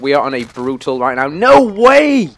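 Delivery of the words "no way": loud, high cry of disbelief; shocked shout. Real, not acted. Gamer reacting to a sudden setback, shocked shout. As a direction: yell it out, stunned and high.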